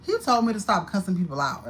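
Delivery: normal talking voice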